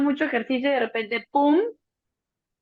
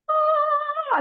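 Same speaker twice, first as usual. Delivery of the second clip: surprised relief. Retold or imitated. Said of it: "Y yo".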